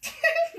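sudden high laugh; high burst of laughter. Real, not acted. Mother laughing at her child's counting. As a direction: laugh out loud at the long count.

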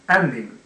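アンディング as English "ending", opening on "eh"; English-style ending. Shown as a demonstration. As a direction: say アンディング with an English open first vowel. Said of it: "イの発音がエー".

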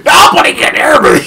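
high, loud shout through laughter; mock holler. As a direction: holler for help in fun, cracking up. Playing along as shouting mountain.

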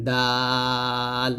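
very long drawn দাল; দাল উচ্চারণ. Shown as a demonstration. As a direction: stretch the vowel of দাল, tongue at upper tooth roots. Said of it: "এরকম উচ্চারণ করবেন". (দাল দাঁতের গোড়া থেকে, লম্বা টানে)

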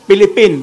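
ฟิลิปปินส์ said as "Pilippin"; Filipino-style ฟิลิปปินส์, starting on "p"; F becomes P. Shown as a demonstration. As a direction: swap the "f" of ฟิลิปปินส์ for "p". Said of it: "เขาออกเป็นเสียงปีหมดเลย".

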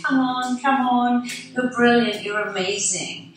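put-on root for your kid in a race; cheering parent. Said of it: "you want your parent to be going".

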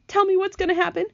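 high, anxious plea; wailing. A narrator's reading. Earthworm begging to know what will happen, wailing. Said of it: "wailed the earthworm".

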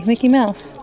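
normal talking voice

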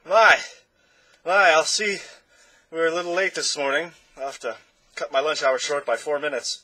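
high, loud, grand conductor just waking; a pompous conductor stretching awake; Chroma's voice. Acted.

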